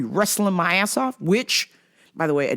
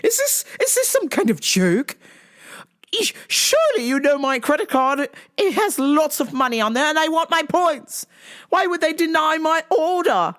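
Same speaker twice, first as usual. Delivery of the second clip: much higher indignant mom voice; mom impression; 10 semitones higher than usual. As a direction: play the offended mom demanding her points. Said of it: "And I sent her the message".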